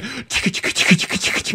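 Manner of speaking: whispery, voiceless "chicka-chicka" sound effect; imitated shaker noise. Co-host mimicking the booze cart server at work, imitated rattle. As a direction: mimic a rapid rattle with soft, toneless syllables.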